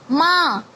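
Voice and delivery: मा held, the आ stretched out; long aa sound. Shown as a demonstration. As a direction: stretch मा so the आ is clear. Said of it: "sound आ रहा ना आ का". (मा में आ की लंबी आवाज़)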